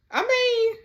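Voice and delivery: surprised squeak. Real, not acted. Reacts to smashed crystal glasses.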